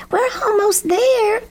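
very high-pitched crow call; shouting crow. A narrator's reading. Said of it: "Lucy Crow shouted".

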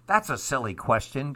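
ordinary delivery, nothing marked

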